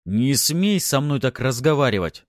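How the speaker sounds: loud, high, threatening order; angry bark. A narrator's reading. Husband threatening his wife, barked. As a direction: bark it as a warning. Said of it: "рявкнул Арманов".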